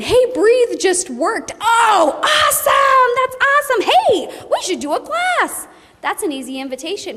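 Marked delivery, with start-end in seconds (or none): 0.0-1.5 high, slow delighted report; high, drawn-out happy caller; happy news. Retold or imitated. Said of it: "You get a call from someone saying". 1.6-5.7 very high, loud delighted shout; very high, loud cheering reply; thrilled shout. Retold or imitated. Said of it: "You get a call from someone saying".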